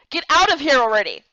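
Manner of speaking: exasperated shout. Acted. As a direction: chase them out for good. Boys thrown out of infirmary.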